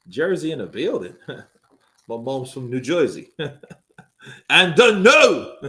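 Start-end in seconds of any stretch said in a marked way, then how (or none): 4.5-5.6 loud, slow announcer voice; announcer call. Pretend. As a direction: draw out the no. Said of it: "I'm gonna say that all day".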